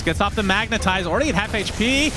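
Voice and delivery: fast, rising hype commentary; play-by-play. Acted. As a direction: call the teamfight as it happens. Live esports play-by-play.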